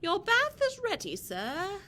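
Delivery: high, loud, prim butler announcing. Acted. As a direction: sing it out like a proper butler. Butler announces bath to villain.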